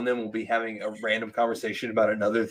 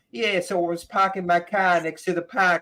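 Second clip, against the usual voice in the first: hammed-up Boston accent, higher pitched; the Bostonian; 7 semitones higher than usual. Mocking a comic who fakes it on stage, broad Boston accent. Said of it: "he may be from Boston".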